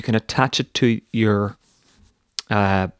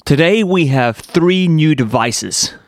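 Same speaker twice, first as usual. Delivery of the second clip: slow, higher product-launch presenter voice in mock American; Steve Jobs; 4 semitones higher, much slower than usual. Host parodies an iPhone launch, slow American keynote voice. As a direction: announce it grandly, drawing out each phrase. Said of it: "Do it like an iPhone launch".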